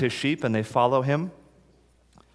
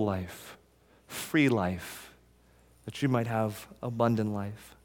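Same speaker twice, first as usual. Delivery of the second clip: tender reading; 3 semitones lower, 6 dB quieter than usual. Acted. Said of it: "But Jesus says".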